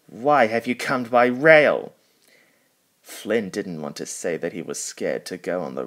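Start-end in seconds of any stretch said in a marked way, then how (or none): 0.1-1.9 stern, much louder boss voice; cross. A narrator's reading. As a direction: scold the engine sharply. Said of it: "said to Flynn crossly".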